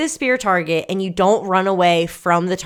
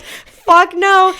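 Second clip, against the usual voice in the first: high, loud flat refusal; emphatic refusal; 11 semitones higher, 7 dB louder than usual. Retold or imitated. Racer refusing the penalty loop, loud. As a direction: shut the idea down hard. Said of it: "I was like".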